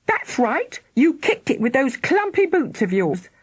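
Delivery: angry mother. A narrator's reading. Mother accusing daughter.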